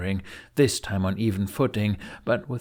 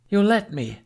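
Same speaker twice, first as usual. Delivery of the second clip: quick, breathless, higher blurt; rushed breath; 11 semitones higher, 4 dB louder than usual. A narrator's reading. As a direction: let it tumble out in one rushed breath. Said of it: "in a rushed breath".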